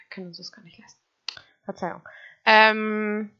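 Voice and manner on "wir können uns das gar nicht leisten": tonlos geflüstert; flüster es besorgt beiseite; Flüstern. Real, not acted. Flüstert Geldsorge. (leise Seitenbemerkung)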